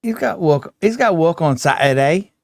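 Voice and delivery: put-on British accent. American mimicking a British friend, mock British accent. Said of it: "do a, a British accent".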